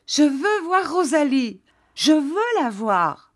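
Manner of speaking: high, near-shouted demand, the second phrase slowed; almost shouting. Acted. Jeanne demanding to see Rosalie, near shout. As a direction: Jeanne cries out for her maid, pitch way up. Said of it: "criant presque".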